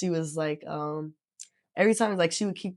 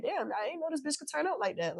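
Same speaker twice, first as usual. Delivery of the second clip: high, soft surprised onlooker; surprised friend voice; 8 semitones higher, 7 dB quieter than usual. Retold or imitated. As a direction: act out a stunned friend. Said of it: "You might be like".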